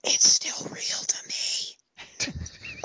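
very slow breathy whisper. Pretend. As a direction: whisper it slowly, mock earnest. Wrestling fan catchphrase whisper.

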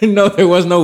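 loud, high, laughing protest; cracking up while answering; laughing denial. Real, not acted. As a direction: deny it through real laughter. Laughs at the cousin joke.

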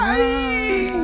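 very high, sharp mock cry of pain; mock high-pitched yelp; virgin's cry. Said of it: "la virgen te dice".

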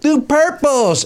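high, stretched-out concert holler; imitated fan yelling. Fan pestering the band for a song, imitated yell. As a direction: call out a request, drawing each word out. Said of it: "some guy kept yelling up".

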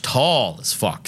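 normal talking voice

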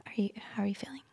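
soft whisper. Real, not acted. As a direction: whisper the question gently. Healer checks on client softly.

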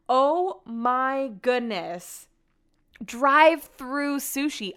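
very slow, stretched-out disbelief on Drive-through sushi; stunned disbelief. Real, not acted. Reacting to the sushi photo.

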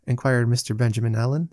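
plain reading voice

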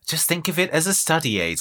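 a hint of villainous coaxing; evil Luann. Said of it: "says evil Luann".

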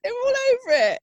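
very high, gleeful mock squeal; gushing squeal. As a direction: squeak it out in exaggerated delight. Squeals listeners' delight at the poodle.

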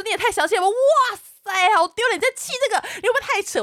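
high-pitched exaggerated disbelief; agitated taunting. Berating boyfriend mid-quarrel, agitated taunting. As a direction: re-enact worked-up scorn, pitch climbing.

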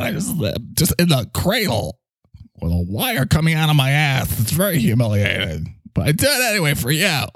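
whiny protest with swooping pitch; put-upon talking microphone. Acted. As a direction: play an aggrieved little microphone pleading its case. Plays Mike the talking microphone.

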